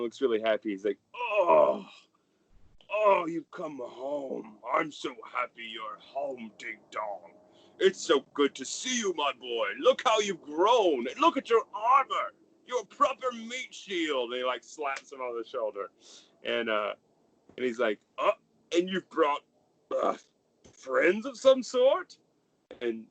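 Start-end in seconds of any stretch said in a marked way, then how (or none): 1.1-14.3 high, merry fatherly gush; jolly father. Acted. The merry father welcoming his son home, high and gushing. 18.2-22.2 high, merry fatherly gush; jolly father. Acted. The merry father welcoming his son home, high and gushing.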